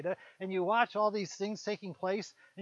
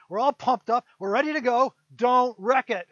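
louder, fired-up pep talk; pumped-up warning; 3 semitones higher, 11 dB louder than usual. Acted. Servant warning Micaiah not to spoil the rally, loud and pumped. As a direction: hype him up and warn him not to spoil it.

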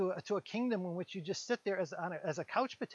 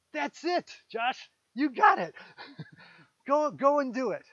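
cheering approval; 5 semitones higher, 6 dB louder than usual. Acted. God cheers on the spirit.

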